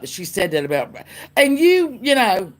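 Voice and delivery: normal talking voice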